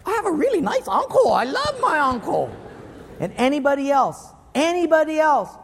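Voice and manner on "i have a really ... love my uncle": high put-on adoring voice; gushing turnaround. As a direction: gush in mock affection, pitched high. Forgives uncle after second check.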